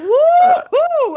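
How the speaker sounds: high, loud laughing whoop; mock cheer. Guest retells his joy at his YouTube impact, whooping and laughing. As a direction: whoop like you just won. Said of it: "I was like".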